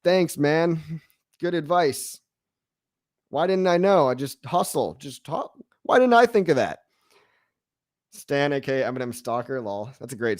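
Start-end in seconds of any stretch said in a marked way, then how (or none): 0.0-6.8 sarcastic thanks. Retold or imitated. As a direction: lay the sarcasm on thicker each line. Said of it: "And you're like".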